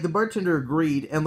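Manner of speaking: ordinary talk, nothing marked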